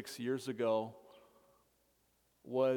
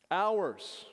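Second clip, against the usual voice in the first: Hours said higher and louder, drawn out with a fall; emphatic echo; 6 semitones higher, 4 dB louder than usual. Real, not acted. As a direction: land hard on Hours for awe.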